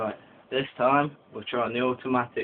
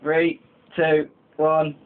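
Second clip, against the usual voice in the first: ordinary delivery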